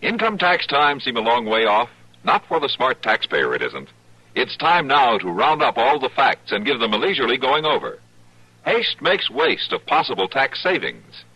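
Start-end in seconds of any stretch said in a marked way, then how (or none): none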